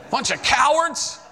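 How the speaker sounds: furious at the robbers for cheating him of heaven; angry outburst. Retold or imitated. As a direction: spit out the scorn, slow and high. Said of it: "Then I started getting angry".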